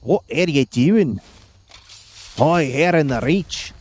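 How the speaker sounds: a hint of a hammed-up Scottish Highlander accent. Player imitating Reachmen from ESO in a light Scottish accent. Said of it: "gave them all Scottish accents".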